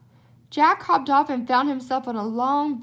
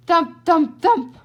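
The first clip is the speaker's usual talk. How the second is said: booming footsteps, much louder; loud thumps; 4 semitones higher, 9 dB louder, slower than usual. A narrator's reading. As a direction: pound out each thump.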